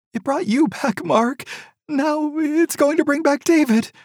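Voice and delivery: high, urgent grieving mother; distraught mother. A narrator's reading. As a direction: plead like a frantic mother. Edith voiced high.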